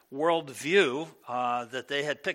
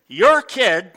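mock blaming wife; 4 semitones higher, 18 dB louder than usual. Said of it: "My wife would say to me".

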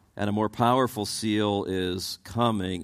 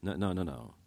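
ordinary delivery

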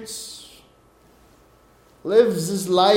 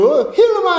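eager greeting; 10 semitones higher, 4 dB louder, faster than usual. Retold or imitated. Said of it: "and he's like".

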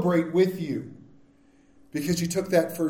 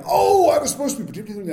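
sudden realization; 8 semitones higher, 6 dB louder than usual. Retold or imitated. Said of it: "I'm like".

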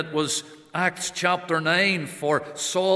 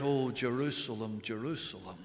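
hushed lament; 6 semitones lower, 11 dB quieter than usual. Acted. Preacher voicing the Lord's lament.

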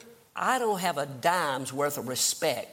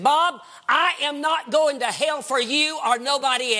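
louder, higher defiant retort; 9 semitones higher, 5 dB louder than usual. Retold or imitated. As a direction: stand your ground. Wife defying husband at pulpit.

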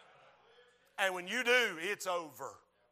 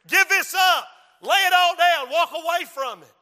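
high, full-force acted shout, easing slightly; shouted demand; 10 semitones higher, 14 dB louder than usual. Devil telling the preacher to give up, shouted demand. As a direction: shout at them to quit.

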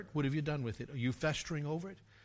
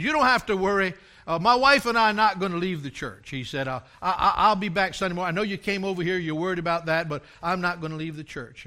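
louder, pressing the man's promise; loud earnest reassurance; 3 semitones higher, 10 dB louder than usual. Retold or imitated. Member reassuring the pastor at his door, loud and earnest. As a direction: insist loudly you're staying.

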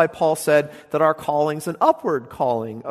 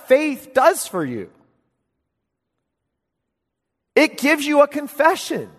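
preaching; 7 semitones higher, 4 dB louder than usual. Acted. Preacher stirring his congregation, full preaching voice.

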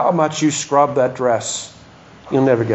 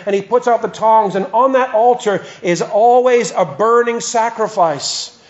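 raised pulpit preaching; 7 semitones higher than usual. Acted. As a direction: proclaim the sacrifices to the congregation. Preaching resumes after aside.

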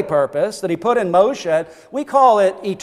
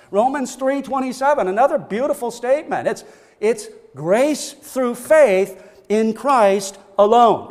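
normal talking voice

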